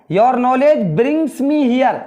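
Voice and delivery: each word drawn out slowly; careful word-by-word classroom reading; slow model reading. Shown as a demonstration. Teacher models English sentence.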